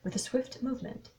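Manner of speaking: ordinary delivery, nothing marked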